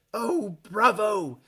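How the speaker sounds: high, drawn-out exclamation of delight; delighted cry. Acted. Wandmaker applauding Harry's wand sparks, crying out delightedly. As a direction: cheer the wand's success in character. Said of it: "Mister Ollivander cried".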